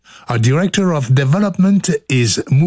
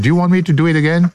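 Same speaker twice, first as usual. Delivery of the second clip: rushed; 2 semitones higher, much faster than usual. Real, not acted. Quick question to Sean.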